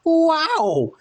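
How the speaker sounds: very high, loud exclamation; Safari Man voice. A narrator's reading. Safari Man bursts in, squeaky falsetto. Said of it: "he declared".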